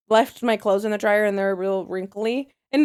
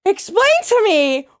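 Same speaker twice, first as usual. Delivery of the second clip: high, drawn-out outraged yell; shriek-demand an answer, stretching the key words; aggressive demand; 13 semitones higher, 4 dB louder, slower than usual. Retold or imitated. Maria demands Jesse explain Gene in her closet, aggressive. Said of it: "why are you so aggressive?".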